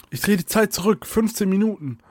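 high, urgent spell call; urgent declaration. A narrator's reading. Soraya turns back time for Alia, urgent and high. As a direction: declare it urgently, pitched well up.

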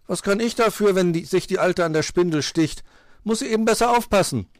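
high, loud cheeky excuse; defiant backtalk. A narrator's reading. Pechmarie denies blame to fox, defiant. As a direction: shrug off the blame loudly. Girl talks back in quarrel.

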